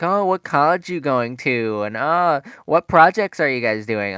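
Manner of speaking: mock cheerful busybody; chirpy substitute teacher. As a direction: gush questions at the students. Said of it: "then she's like".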